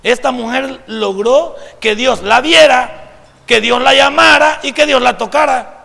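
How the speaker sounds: loud, emphatic climax; full-force preaching. Real, not acted. Preacher proclaims what the woman achieved, full-force preaching. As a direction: drive each phrase home loudly.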